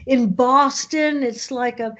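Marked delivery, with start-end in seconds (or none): none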